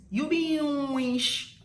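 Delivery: "wish" stretched long and loud; drawn-out Warri pronunciation. Shown as a demonstration. Introducing a funny Warri saying, loud and drawn out. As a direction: drag "You be wish" out loudly. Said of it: "They draw it when they are saying it".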